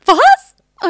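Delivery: high, loud stunned what; a shrieked, disbelieving what; shocked what. Retold or imitated. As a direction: gasp out the word in disbelief. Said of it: "Und ich war so".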